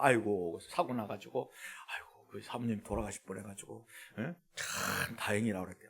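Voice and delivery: low, soft imitation of someone sighing with relief; lightly acted murmur of thanks; hushed relieved testimony. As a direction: retell it quietly, under your breath. Retells someone's relieved, grateful sighing.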